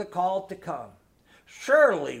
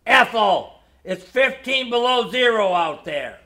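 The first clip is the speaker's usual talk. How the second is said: thundering protest; 3 semitones higher, 9 dB louder than usual. A narrator's reading. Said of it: "my father thundered".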